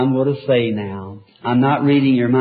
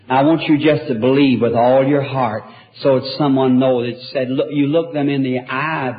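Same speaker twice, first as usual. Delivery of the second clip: ordinary talk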